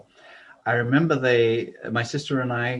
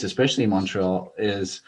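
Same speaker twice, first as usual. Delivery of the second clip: normal talking voice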